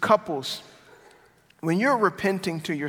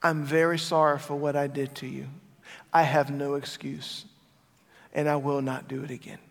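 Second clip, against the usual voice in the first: a little softer, lower, earnest sorry; sincere apology; 5 semitones lower, 5 dB quieter than usual. Shown as a demonstration. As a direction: apologize humbly, meaning every word. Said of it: "Repentance sounds like this".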